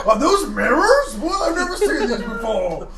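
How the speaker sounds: high hammed-up surprise; mock surprise. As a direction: play fake astonishment. Pretending to notice ceiling.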